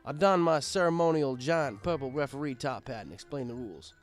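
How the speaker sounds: ordinary delivery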